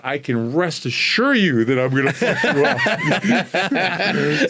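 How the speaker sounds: slow, amused threat through a laugh. Retold or imitated. Telling a loudmouth he'll beat him, slow and laughing. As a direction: savour each word of the threat, chuckling. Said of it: "I said".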